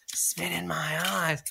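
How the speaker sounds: slow, low, hushed urging; imitated panting voice. Boy urging partner on while making out, slow panting murmur. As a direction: pant it softly, as if into someone's ear. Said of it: "that kind of like panting voice in my ear".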